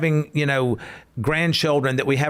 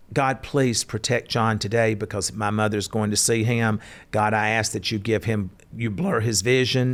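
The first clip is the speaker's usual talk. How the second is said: lower, hushed mock-solemn prayer voice; 7 semitones lower than usual. Son praying for the doctor before his mother's visit, mock-solemn. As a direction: pray for him with a wink.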